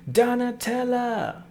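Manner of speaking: high, drawn-out cry of the name; slow, high, theatrical call; excited fan cry. Acted. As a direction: sing out the name in a high put-on voice. Calls out the name admiringly.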